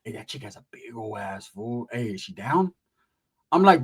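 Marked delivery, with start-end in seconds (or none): 0.0-2.7 softer, lower vato voice; low bulldog homie. Retold or imitated. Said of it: "He was like".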